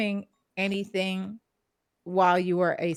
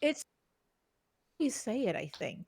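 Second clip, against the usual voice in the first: normal talking voice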